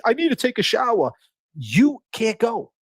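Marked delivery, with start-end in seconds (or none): 0.0-1.1 mock needy whine. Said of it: "if you're needy".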